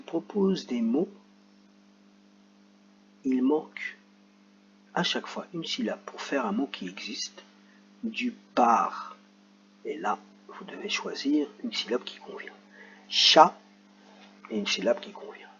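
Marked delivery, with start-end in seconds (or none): 3.2-7.4 soft whisper; whispering the rule to the pupils; whispered explanation. Real, not acted. 9.8-12.5 soft whisper; whispering the rule to the pupils; whispered explanation. Real, not acted. Whispered instruction.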